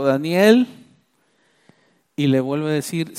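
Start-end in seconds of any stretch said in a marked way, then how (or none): none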